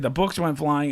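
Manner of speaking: ordinary delivery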